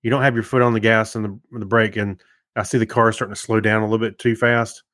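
ordinary talk, nothing marked

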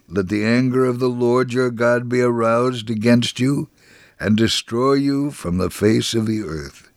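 ordinary talk

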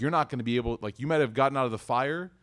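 ordinary talk, nothing marked

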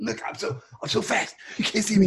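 high giddy stoner voice; spaced-out stoner bragging. Pretend. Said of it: "Man, I'm so baked right now".

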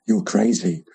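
normal talking voice

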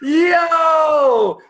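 high, loud, drawn-out cries of disbelief; elated shouting. Retold or imitated. Client reacting to dropped charges, elated shout. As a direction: shout it high and hold the last word.

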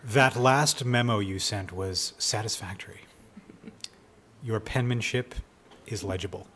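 whispered stiff corporate memo; corporate note read out. Retold or imitated. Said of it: "some of the great corporate ones could be".

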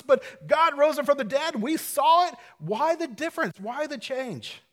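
high, loud, urgent preaching; preaching at full force. Acted. Preacher driving home the point to his congregation, at full force. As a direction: lift the whole room, building up.

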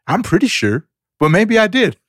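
high-pitched mock self-doubt; retold comic uncertainty; unsure reply. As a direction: answer high, as if starting to doubt it. His baffled reply, retold.

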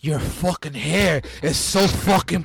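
slow, exaggerated Cuban accent. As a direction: drag it out in a hammy Cuban accent. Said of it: "Al Pacino's bad Cuban accent".

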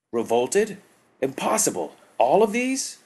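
rising to a high, loud incredulous cry; disbelief. A narrator's reading. Tagath hears of the slave revolt, incredulous. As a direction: refuse to believe it.

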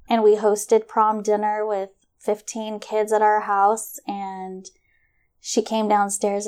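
usual speaking voice

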